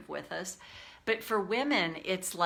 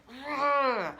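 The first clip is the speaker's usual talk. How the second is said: mock wail; 6 semitones higher, 4 dB louder than usual. Describing explosive emotional release.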